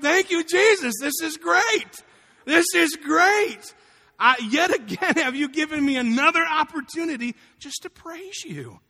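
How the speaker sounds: loud, high, jubilant shout of praise; laughing exultation. Retold or imitated. Man thanking Jesus after the crash call, loud elated shouting. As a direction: shout it to heaven, stretching the big words. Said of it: "I just started laughing".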